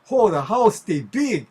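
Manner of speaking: Hawaiian pidgin exclamation, loud high start. Shown as a demonstration. Local just saying the house is large, Hawaiian pidgin. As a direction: call it out in everyday Hawaiian pidgin. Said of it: "not just say".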